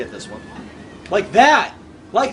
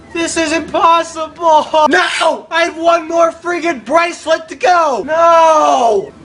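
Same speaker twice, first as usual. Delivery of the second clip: high, raised cry of dismay; frustrated shout; 4 semitones higher, 4 dB louder, slower than usual. Real, not acted. Player one bracelet short, shouting in frustration. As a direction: yell it as the last chance slips away. Failing near the goal.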